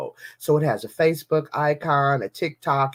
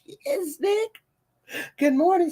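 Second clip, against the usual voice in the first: high bright greeting. Real, not acted. Greeting Nick in the room, high and sing-song.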